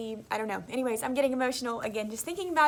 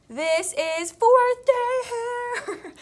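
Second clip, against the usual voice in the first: much higher, very slow, thrilled announcement; excited reveal; 11 semitones higher, 7 dB louder, much slower than usual. Real, not acted. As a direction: show off the hair with delight. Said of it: "I'm so excited".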